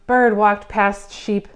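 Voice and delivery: usual speaking voice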